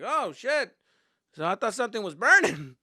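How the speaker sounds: high, loud mock alarm; alarmed neighbour. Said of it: "they're like".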